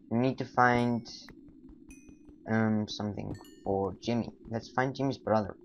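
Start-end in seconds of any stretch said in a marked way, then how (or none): none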